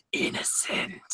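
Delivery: hushed, whispered verdict; Ghost Rider. Retold or imitated. Fan acts out him pointing at a young boy, whispered verdict. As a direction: imitate a menacing whisper, voice drained out. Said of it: "does the point and says".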